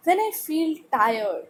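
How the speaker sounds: slow, clear read-aloud for a class. Acted. Teacher reading the paragraph to students, slow and clear. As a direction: read each word slowly to pupils. Reads text slowly.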